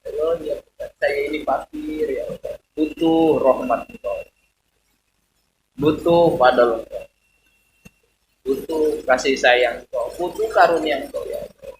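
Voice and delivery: soft, pleading prayer fading at each end; humble, tender supplication; soft heartfelt prayer. Shown as a demonstration. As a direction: pour it out quietly to God. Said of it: "ada yang bersambung".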